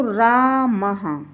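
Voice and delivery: रामः stretched out as "raa-ma-ha"; visarga as ha. Shown as a demonstration. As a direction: sound out रामः with a full final ha. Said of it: "राम में विसर्ग लगा हुआ है". (रामः, अंत में साफ़ हा)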